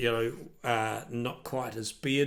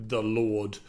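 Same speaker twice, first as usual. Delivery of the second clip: normal talking voice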